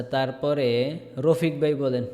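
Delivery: ordinary talk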